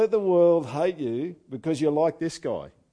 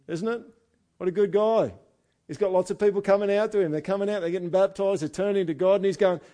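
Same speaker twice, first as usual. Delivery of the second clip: ordinary talk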